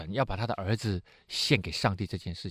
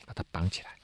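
whisper; 6 semitones lower, 9 dB quieter than usual. Acted. Abraham binding his son.

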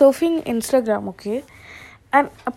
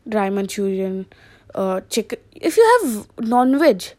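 usual speaking voice

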